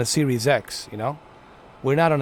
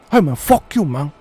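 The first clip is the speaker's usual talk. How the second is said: put-on accented gangster voice, pitched up and loud; Tony Montana; 2 semitones higher, 6 dB louder than usual. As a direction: curse them out as a Cuban crime boss. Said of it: "I'm fucking Tony Montana".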